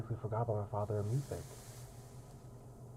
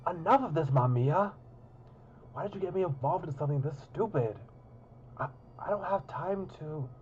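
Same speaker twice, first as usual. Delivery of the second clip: exasperated; 8 semitones higher, 3 dB louder than usual. Acted. Annoyed outburst at Mamiya.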